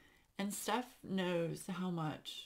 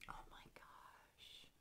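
much softer, slow whisper, overwhelmed; breathless whisper; 21 dB quieter, slower than usual. Real, not acted. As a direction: barely breathe it out. Overwhelmed by memorial gift.

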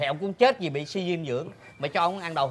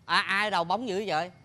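normal talking voice

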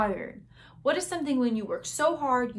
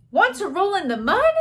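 lightly voiced pig, higher and louder; pig's invitation; 8 semitones higher, 8 dB louder than usual. A narrator's reading. Pig inviting the spider to play, high lively asking. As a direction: ask it as an eager storybook pig. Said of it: "grunted the pig".

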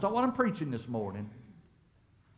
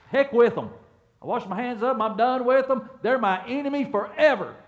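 loud, higher, fed-up dismissal; mock angry outburst; 7 semitones higher, 8 dB louder than usual. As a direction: throw them away like you're done for good. Acted quote of angry dismissal.

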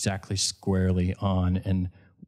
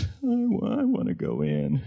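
Linda. Retold or imitated. Wife asks guide to take her in, small put-on voice. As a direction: imitate a wounded woman asking to leave. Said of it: "and Linda goes".

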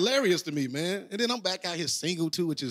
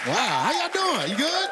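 excited greeting; 11 semitones higher, much slower than usual. Real, not acted. Comic greeting the audience, hyped. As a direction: greet the crowd all fired up. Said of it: "I'm really excited to be here".

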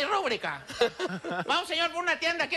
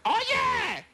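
calling out; 6 semitones higher than usual. Acted. Cockroach calls to another in joke.

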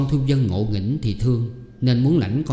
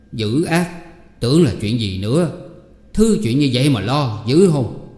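scoffing exclamation; 2 semitones higher, 3 dB louder than usual. Acted. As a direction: brush off her worry with a loud scoff. Uncle scoffing at her worry. (giọng chế giễu gắt gỏng)

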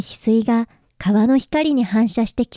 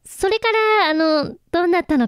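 flustered; 6 semitones higher, faster than usual. Acted. Akane covers embarrassment about proposal.

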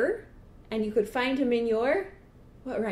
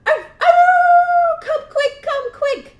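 very high, loud bark and howl; urgent high-pitched dog yelp; 15 dB louder, much slower than usual. Acted. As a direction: howl the alarm for your people. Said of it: "Dog barked!".

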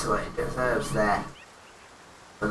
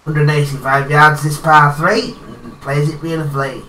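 golf commentator; 4 dB louder than usual. Acted. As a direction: call the shot like a TV golf announcer. Player narrates his golf game.

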